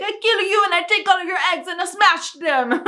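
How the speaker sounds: comic mock-French threat at an annoying bird; Peter Stormare's French accent. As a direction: rail at the bird in an over-the-top French accent. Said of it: "this ridiculous French accent".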